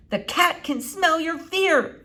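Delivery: high, slow called-out warning; shouting while running. Acted. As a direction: yell it back while running. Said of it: "Flora shouted as she ran".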